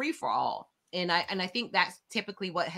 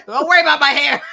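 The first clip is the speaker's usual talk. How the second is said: much higher, louder, laughing through it; laughing off comments about her hair; 9 semitones higher, 10 dB louder than usual. Real, not acted. Laughing at her own story.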